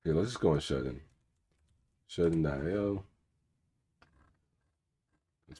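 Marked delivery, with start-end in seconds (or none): none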